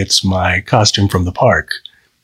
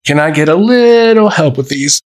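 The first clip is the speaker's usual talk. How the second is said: playful; 3 semitones higher than usual. A narrator's reading. Man at the door with packages, playful. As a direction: ask for help with a playful lilt. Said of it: "he playfully asked".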